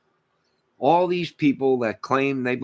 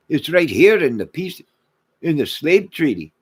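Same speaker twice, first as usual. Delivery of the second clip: mock complaint; 3 semitones higher, 6 dB louder than usual.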